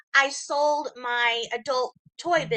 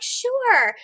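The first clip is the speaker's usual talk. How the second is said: chirpy; 11 semitones higher, 3 dB louder than usual. Retold or imitated. Company replies cheerfully.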